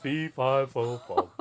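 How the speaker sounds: slow booming giant; loud, slow giant rumble. Pretend. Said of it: "Like you're a giant".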